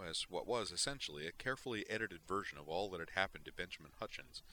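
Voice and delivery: ordinary delivery, nothing marked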